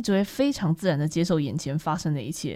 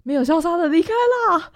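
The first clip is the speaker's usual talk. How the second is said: usual speaking voice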